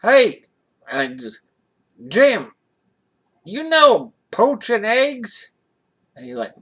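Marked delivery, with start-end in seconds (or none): 0.0-0.4 loud, high shout across the diner; waitress yelling for the cook; calling out. Retold or imitated. 2.1-5.5 high, loud, slow call to the kitchen; waitress hollering to the cook. Retold or imitated.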